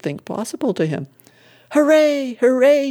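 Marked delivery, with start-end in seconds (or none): 1.7-2.9 high, louder triumphant whoop; shouted cheer. A narrator's reading. Widow cheering the deacon's win from the road, shouting. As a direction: cry it out as a raised, high hurrah. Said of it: "shouted the widow".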